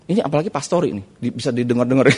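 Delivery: ordinary talk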